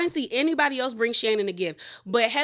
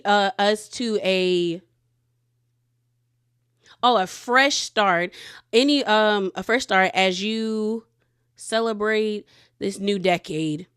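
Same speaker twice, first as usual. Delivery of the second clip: slow, loud card reading; deliberate, louder read-out with stumbles; reading aloud; 4 dB louder than usual. Real, not acted. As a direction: read a greeting card out haltingly.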